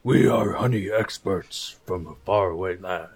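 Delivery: low, rumbling bear talk. Acted. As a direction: speak like a big bear. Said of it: "You do that voice way too well".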